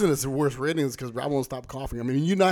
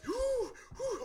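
winded puffing; 7 dB quieter than usual. Retold or imitated. Guy comes back.

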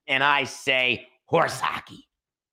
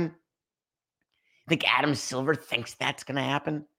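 hushed, breathy rhetorical whisper; 2 semitones higher, slower than usual. Real, not acted. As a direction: lean in and whisper it, incredulous. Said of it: "whispered rhetorical".

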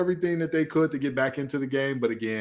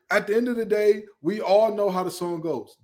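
usual speaking voice

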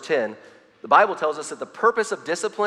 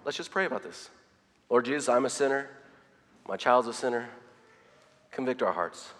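softer, gentle, humble prayer; calm prayer; 3 semitones lower, 6 dB quieter than usual. Retold or imitated. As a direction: pray quietly, calming the moment. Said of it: "but calmly say".

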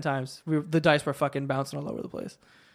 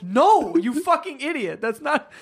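higher, loud mock-furious correction; imitated angry outburst; 7 semitones higher, 4 dB louder, slower than usual. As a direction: act out an exasperated friend losing it. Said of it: "Pete was getting so mad".

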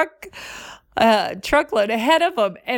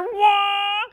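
excited whoop; 11 semitones higher than usual. Retold or imitated. Racing story sound effects.

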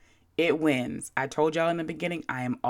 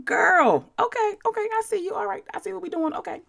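much higher, incredulous okay okay; high mocking I-see-you; sassy high disbelief; faster than usual. Real, not acted. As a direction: pitch up high, half-laughing, like you've caught someone out. Sassy reaction to rude email.

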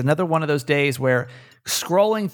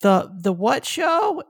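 put-on higher, slow, puzzled little kid; small child's voice; 8 semitones higher, slower than usual. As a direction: ask it slowly like a confused toddler. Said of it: "her kid was like".

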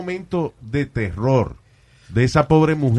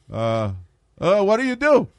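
caller voice; 6 semitones higher than usual. Retold or imitated. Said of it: "acting caller".

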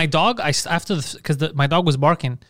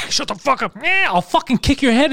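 higher threatening mock yell; 9 semitones higher than usual. Owner threatens the hissing cat, hammed-up anger. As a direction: threaten the cat, half joking.

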